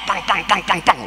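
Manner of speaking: rapid high "pang" hoof noises; imitated gallop. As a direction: pound out galloping hooves with the voice.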